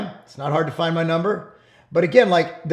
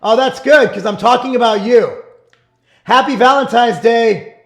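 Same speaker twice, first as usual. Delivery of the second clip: higher, louder teasing call to his wife; playful teasing; 5 semitones higher, 7 dB louder than usual. Real, not acted. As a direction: tease her with a big cheerful voice.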